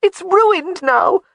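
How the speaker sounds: high, loud tearful wail. Acted. Jamie wailing to Alec her surprise is spoiled, high and loud. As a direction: cry it out, voice way up. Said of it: "she wailed".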